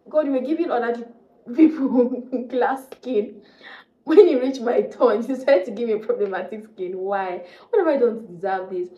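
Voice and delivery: a woman vents to God about her skin, exasperated; exasperated plea to God. Retold or imitated. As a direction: throw your hands up at God, half laughing. Complaining to God in prayer.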